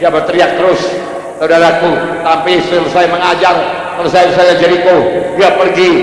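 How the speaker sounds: normal talking voice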